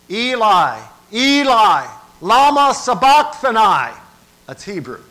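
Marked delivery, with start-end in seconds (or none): 0.1-4.0 high, full-force cry to heaven; loud cry. Acted. Preacher voices Jesus crying from the cross, loud anguished cry. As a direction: cry it out at the top of the voice. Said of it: "Jesus cried with a loud voice".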